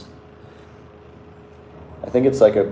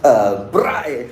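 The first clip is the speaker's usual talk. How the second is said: proud South African "braai"; 2 semitones higher, 4 dB louder than usual. Retold or imitated. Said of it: "They say it like they're so proud".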